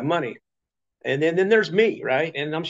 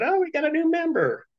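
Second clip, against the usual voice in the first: cheery Colette. Retold or imitated. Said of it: "Colette's going".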